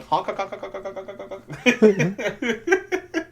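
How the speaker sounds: high goose honking; silly clown. Retold or imitated.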